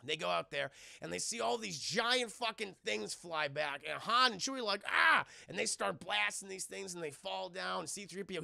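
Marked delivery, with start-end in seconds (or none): 4.9-5.2 short, high, loud mock scream; startled yell. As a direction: cry out in acted shock. Han and Chewie yell, retold.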